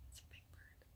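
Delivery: breathy aside whisper. Real, not acted. Explaining the bird's size, whispered. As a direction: whisper it to the camera.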